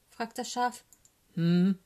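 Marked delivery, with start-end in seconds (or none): 1.3-1.8 loud, grumbly bear hum; non-committal bear grunt; bear's grumble. A narrator's reading. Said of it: "brummt Eddie Bär".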